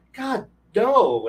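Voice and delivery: high, exasperated retold no; appalled refusal. Game master rejecting player's war plan, appalled.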